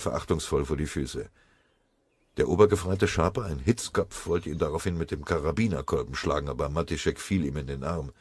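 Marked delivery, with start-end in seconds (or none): none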